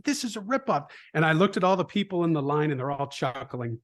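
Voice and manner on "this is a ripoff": put-on grumbling kid. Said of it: "And he said".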